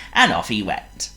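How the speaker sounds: ordinary delivery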